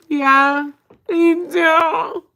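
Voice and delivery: high, loud whine; mock tearful reply. Retelling her answer to him, mock tearful wail. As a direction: wail it like someone about to cry.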